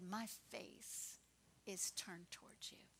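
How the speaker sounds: the Lord softly reassuring a grieving father; gentle reassurance. Retold or imitated. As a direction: speak it like a quiet promise.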